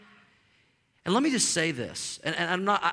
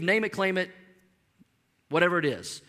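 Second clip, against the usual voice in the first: normal talking voice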